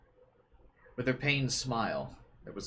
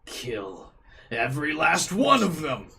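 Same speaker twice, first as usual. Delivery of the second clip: slow, much louder, higher commanding roar; Dimitri impression; 7 semitones higher, 9 dB louder, slower than usual. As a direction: bellow the order like a ruthless warlord. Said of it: "That was my Dimitri impression".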